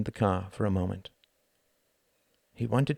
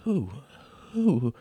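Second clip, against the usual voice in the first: dazed; 7 semitones higher than usual. Acted. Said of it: "said Wendy, dazed".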